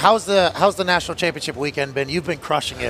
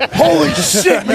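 laughing; 9 semitones higher, 5 dB louder, slower than usual. Real, not acted. Laughing at the guest's greeting.

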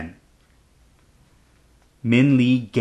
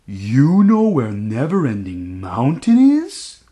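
slow, louder astonished question; amazed; 5 dB louder, slower than usual. Acted. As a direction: ask in disbelief. Dragon astonished.

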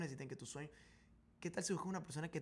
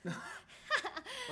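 risa aguda y repentina; risa sorprendida. Real, not acted. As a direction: se le escapa una carcajada aguda. Broma de que la lectura era sobre ella. (carcajada aguda de sorpresa)

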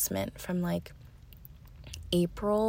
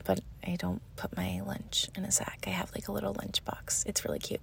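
unvoiced little whisper aside; 8 dB quieter than usual. Real, not acted. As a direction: drop to a secret whisper. Whispered aside about lunch box.

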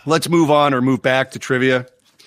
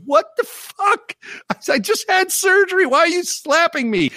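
high, stretched pained outrage; outraged yelp. Retold or imitated. Retelling his reaction to the buddy's slap, high outraged yelp. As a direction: yelp it like you just got hit.

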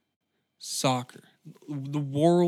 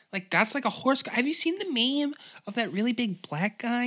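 higher chatty waitress voice; Sally rambling, higher than the chef; 7 semitones higher than usual. Acted. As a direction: gossip on in the girl voice.

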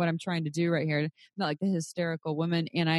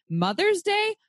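mock outrage; 13 semitones higher, slower than usual. Mocking the email.

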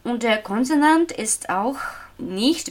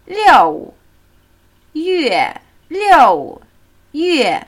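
"Liu" and "Yue" each fall from high, "Liu" louder; two falling tones; 5 semitones higher, 7 dB louder, much slower than usual. Shown as a demonstration. Teacher demonstrating the month June, two falling tones. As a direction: drop "Liu", then drop "Yue" the same way. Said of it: "Zwei fallende Töne".

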